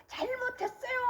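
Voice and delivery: high, wailing re-enacted apology; childlike begging. Repenting before God like a begging child, high tearful wail. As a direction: plead tearfully like a scolded child. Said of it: "애기가 엄마 아빠한테 막 이렇게 비는 것처럼".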